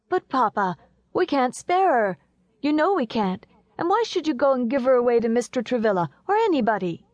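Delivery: put-on high-pitched pleading child voice; vehement young boy. A narrator's reading. Young Horace pleading with Papa to keep Elsie, high protest. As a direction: argue back like an upset little boy.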